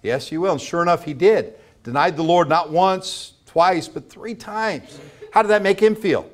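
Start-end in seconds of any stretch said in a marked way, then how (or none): none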